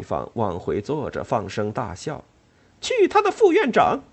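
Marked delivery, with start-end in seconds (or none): 2.8-4.0 very high, loud curse; furious shout. Acted. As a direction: bellow it defiantly. Ellen cursing from the table.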